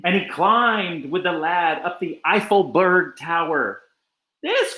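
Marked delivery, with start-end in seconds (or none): 4.4-4.8 high cry of a tiny mayor. A narrator's reading. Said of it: "cried the mayor".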